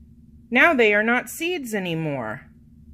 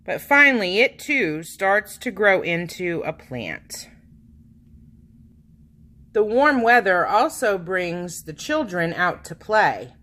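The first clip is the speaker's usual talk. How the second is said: ordinary delivery, nothing marked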